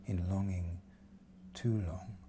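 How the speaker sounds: dramatic poetry reading; poem recitation. Acted. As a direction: recite it like a love song spoken aloud. Whole piece a recited love poem.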